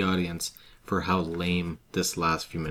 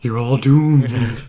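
slow, loud doom-laden warning; slow ominous raspy drawl; 5 semitones higher, 10 dB louder, much slower than usual. Retold or imitated. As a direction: drag out doomed like a prophet of doom. Imitating Crazy Ralph's warning.

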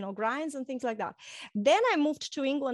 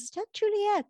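higher-pitched imitation of a surprised friend; retold surprised question; 9 semitones higher than usual. Friend on the phone recognizing her voice, mock surprise. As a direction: lightly mimic a caller checking who answered.

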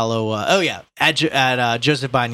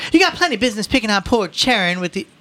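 high, loud indignant mother; scolding mother; 12 semitones higher, 5 dB louder than usual. A narrator's reading. Mother telling off the policeman, indignant. Voices Mrs. Riley.

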